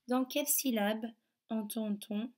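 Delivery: normal talking voice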